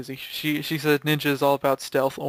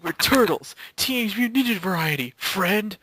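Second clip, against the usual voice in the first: pitched-up hammy announcement; playful excited cry, a bit higher; mock cheerful shout; 5 semitones higher than usual. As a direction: proclaim it like a cartoon intro.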